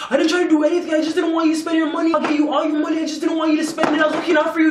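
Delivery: high, whiny mock of his pleading; imitated pleading excuses. Boyfriend defending himself to her, mock pleading.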